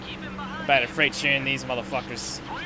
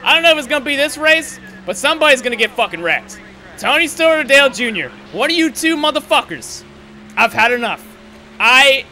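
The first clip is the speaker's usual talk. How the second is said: real fury, higher and louder throughout; furious rant; 7 semitones higher, 6 dB louder than usual. Real, not acted. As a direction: vow revenge at the top of your voice. Ranting at rival drivers.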